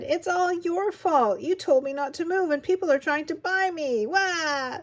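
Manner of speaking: high, loud whining outburst; loud tantrum ending in a mock cry; child's wailing complaint. A narrator's reading. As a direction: wail accusingly like an upset kid. Child wails blame at father.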